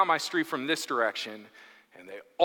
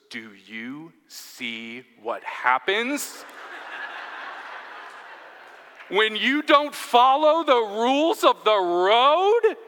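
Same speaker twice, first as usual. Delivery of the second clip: lecturing dad; 4 semitones higher, slower than usual. Retold or imitated. Dad warning his teenage kids, slow escalating lecture. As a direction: draw it out, rising to a parental shout. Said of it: "this dad points at the helicopter and goes".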